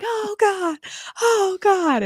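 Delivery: very high mock-panicked cry; over-the-top wail. Retelling an absurd comedy scene, over-the-top wail. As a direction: imitate a hammed-up comedy freak-out. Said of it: "like, so over the top".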